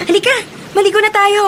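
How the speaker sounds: high bright call; eager invite. Acted. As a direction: urge her into the water gleefully.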